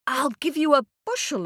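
lightly voiced small boy; boy bargaining. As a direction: offer it like a hopeful child.

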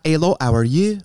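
put-on a light French Canadian accent, rising on you; breezy Quebec greeting. Host demos the French Canadian voice again, light Quebec accent. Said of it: "do a French Canadian voice".

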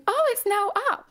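very high, put-on client voice; Linda Evangelista impression. Client sees machine working, high-pitched surprise. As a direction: pipe up high in mock surprise.

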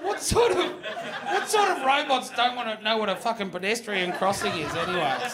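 high, laughing disbelief; amused outrage through laughter. Real, not acted. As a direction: squeak it out still laughing. After a long laughing fit.

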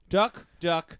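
slow, stalling delivery; saying it slow. Real, not acted. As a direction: draw the words out to buy time. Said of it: "He's saying it slow".

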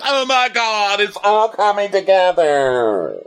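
high, slow awed gush; mock rapture. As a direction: swoon over it dramatically. Mock awe at the scene.

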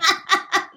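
short high burst of laughter; real laughter. Real, not acted. Friends joking about what to tell the clerk, short high laugh. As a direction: laugh at the awkward idea. Amused at the suggested reply.

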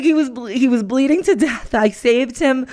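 rattling off the story. Retold or imitated. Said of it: "she's like".

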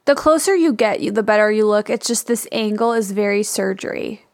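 ordinary talk, nothing marked

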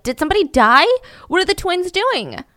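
retold worried questioning, rising high at the end; mildly panicked mock questioning; frantic. Mother calling about the twins, frantic. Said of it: "calls Celeste frantically".

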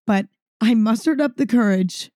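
normal talking voice

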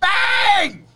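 very high, loud burst; exclaimed sound effect. Pretend. Co-host celebrating the date being found, loud exclamation.

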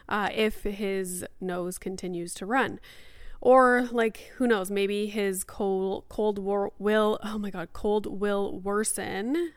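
normal talking voice

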